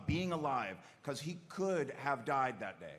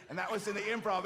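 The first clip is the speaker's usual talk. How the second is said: usual speaking voice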